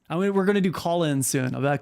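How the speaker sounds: ordinary talk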